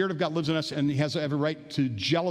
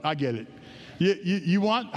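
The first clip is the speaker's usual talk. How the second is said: normal talking voice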